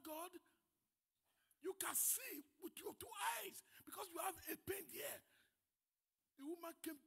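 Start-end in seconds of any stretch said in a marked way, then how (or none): 1.6-5.2 shouting. Real, not acted. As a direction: hammer the point home at the crowd. Preacher shouts at the congregation.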